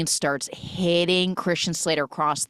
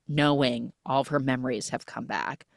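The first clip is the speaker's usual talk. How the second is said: usual speaking voice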